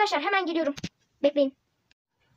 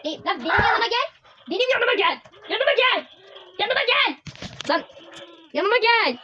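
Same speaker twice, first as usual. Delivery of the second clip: yelling at teammate; 6 semitones higher than usual. Real, not acted. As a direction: shout at him to come over. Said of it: "Bağırıyorum sana bak".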